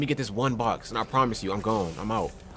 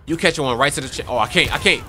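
usual speaking voice

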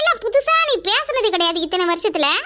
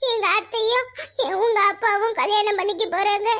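ordinary delivery

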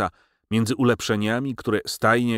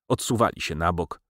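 plain reading voice

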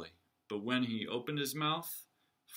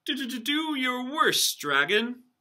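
very high, loud stammering squeak; squeaky knight; 13 semitones higher, 9 dB louder than usual. Acted. Knight daring the dragon to fight, squeaky. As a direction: squeak the challenge through a stutter.

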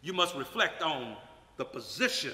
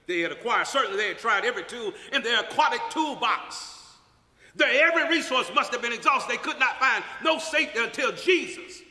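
preaching at full force; 6 semitones higher, 4 dB louder than usual. Acted. Preaching climax.

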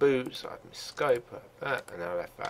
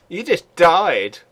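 gloating taunt; 6 semitones higher, 10 dB louder, much slower than usual. Real, not acted. Gloating over kill.

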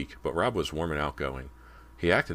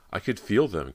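higher, louder, emotional outburst; 6 semitones higher, 5 dB louder than usual. A narrator's reading. Cody telling Rob of her memories, emotional. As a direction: let the feeling lift your voice. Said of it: "Cody continued emotionally".

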